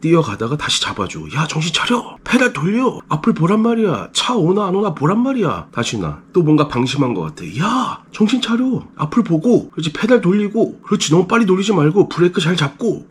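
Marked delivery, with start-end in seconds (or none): 1.3-5.7 urgent high-pitched calling out; acted parent yelling instructions, voice pitched way up; acted nagging coach. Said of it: "너무 옆에서 시끄럽고 막 잔소리하고". 7.6-13.0 urgent high-pitched calling out; acted parent yelling instructions, voice pitched way up; acted nagging coach. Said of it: "너무 옆에서 시끄럽고 막 잔소리하고".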